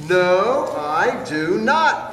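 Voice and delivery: loud, slow, stubborn growl; mock growl. Smelly bear refusing the bath, loud slow mock growl. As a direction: dig in your heels and growl it. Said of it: "growled Big Smelly Bear back".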